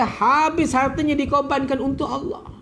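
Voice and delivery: usual speaking voice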